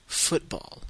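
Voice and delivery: football said the American way, FOOT-ball; football as an American says it, for the class; American English. Shown as a demonstration. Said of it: "football américain".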